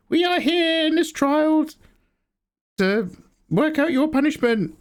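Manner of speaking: high, slow, pompous judge voice; wizard judges. Retold or imitated. Streamer voicing cartoon wizard judges, high and slow. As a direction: announce the sentence like a lofty tribunal. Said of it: "they're like".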